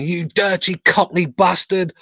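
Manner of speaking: mild mock taunt; imitating a jeering fan. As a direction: drag out the insult like a rival supporter. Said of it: "one guy going".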